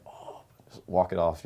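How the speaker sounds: usual speaking voice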